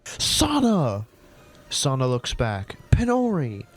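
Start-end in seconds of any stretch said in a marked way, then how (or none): 0.0-1.1 calling out. Acted. Calling out for lost friend. 2.9-3.6 calling out. Acted. Calling back to friend.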